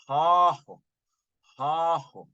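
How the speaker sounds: "hahu" with a long, drawn-out ah; elongated ah. Shown as a demonstration. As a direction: stretch the first vowel of "hahu". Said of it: "That's an elongated ah sound".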